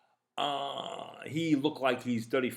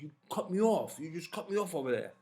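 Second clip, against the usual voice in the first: imitating a mildly upset driver, higher-pitched; a little upset; 6 semitones higher than usual. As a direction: complain with contained annoyance, not yelling. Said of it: "I could see he's a little upset".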